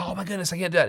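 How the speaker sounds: high-pitched mock dismay; imitating a shocked onlooker; scandalized listener. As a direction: play an appalled churchgoer. Voices scandalized listeners reacting to Paul.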